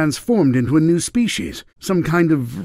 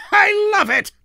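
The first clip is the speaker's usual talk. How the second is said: high, delighted villain cry; gleeful shout. Acted. Villain hears Sonic is being harassed, gleeful shout. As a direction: crow it with wicked glee.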